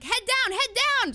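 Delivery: high, loud barked order to duck; the lift guy yelling head down at her; urgent shout. Retold or imitated. The lift worker shouts urgent orders at her, high and loud.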